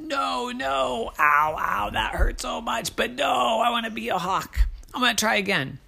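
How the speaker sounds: wince, then insist; hurt determined crow. Acted. Ike in pain, still insisting to the hawks.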